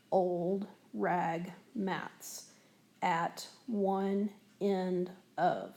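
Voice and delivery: very slow, every syllable spaced out; very slow syllable reading. Shown as a demonstration. Teacher reading the passage aloud to count syllables: very slow. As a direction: read it out beat by beat. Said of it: "say it real slowly".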